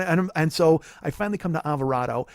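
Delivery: ordinary talk, nothing marked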